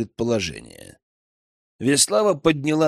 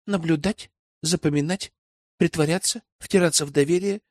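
put-on higher girl's voice, measured list; Веслава listing skills; 3 semitones higher than usual. Young spy lists her training for elder, measured. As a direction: count off each skill in a light, raised register.

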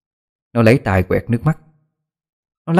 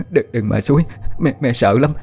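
tearful begging; 2 semitones higher than usual. Acted. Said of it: "Bà vừa khóc vừa van xin".

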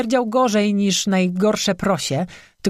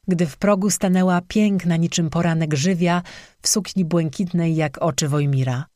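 ordinary delivery

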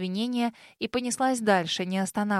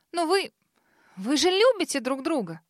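shouting; 5 semitones higher than usual. A narrator's reading. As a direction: cry it out in protest. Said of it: "выкрикнула Касси". (выкрикнула)

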